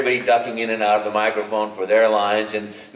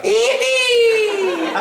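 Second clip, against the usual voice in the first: high, shouted Mario yippee; 4 dB louder than usual. Retold or imitated. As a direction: squeal it like Mario. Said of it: "And I'm like".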